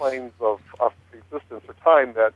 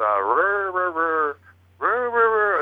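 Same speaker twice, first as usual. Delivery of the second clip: imitated rolling rrr growls climbing higher; weird growling cadence; 6 semitones higher, much slower than usual. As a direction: mimic a strange spirit voice's growl. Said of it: "it starts off at a really weird cadence".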